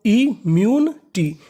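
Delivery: Immunity drawn out slowly, im-myu-ni-ti spaced apart; slow syllable by syllable. Shown as a demonstration. Teacher sounding out immunity slowly to students. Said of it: "तो meaning".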